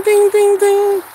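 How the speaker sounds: high sung ding ding ding; light chiming ding like a dashboard alert; car chime imitation. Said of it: "my car even ding".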